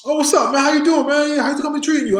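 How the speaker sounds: high-pitched, friendly retold hello; delighted greeting. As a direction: imitate a star greeting them eagerly. Acting out star's delighted greeting.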